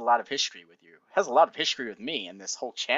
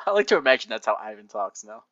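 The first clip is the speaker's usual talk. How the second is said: ordinary delivery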